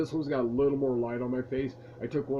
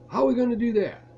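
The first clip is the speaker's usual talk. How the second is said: eager; 9 semitones higher, 7 dB louder, faster than usual. Retold or imitated. Friend eagerly asks the plan.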